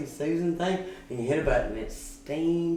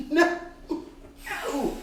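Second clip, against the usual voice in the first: laughing no; 13 semitones higher than usual. Retold or imitated. Laughing protest in story.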